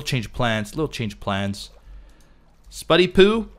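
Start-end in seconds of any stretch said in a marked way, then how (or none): 2.7-3.5 high, loud sing-song call; calling a pet. Real, not acted. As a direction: coax the pet over with a cutesy name.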